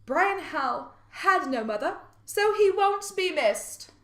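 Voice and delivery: slow, lightly voiced quote, pitch lifting; Maryの発言の引用. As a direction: read the quote out slowly and deliberately. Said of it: "Mary said such things as, quote".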